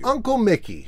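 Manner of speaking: high-pitched call to an uncle; mild shout. A narrator's reading. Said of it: "shouted Morty Mouse".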